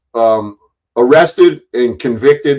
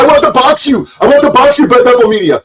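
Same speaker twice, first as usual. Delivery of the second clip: high, loud mocking whine of the rival; mocking imitation; 11 semitones higher, 5 dB louder, faster than usual. Streamer mimicking a rival's boxing challenge, mocking. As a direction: sneer his challenge back at him. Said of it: "you're gonna go".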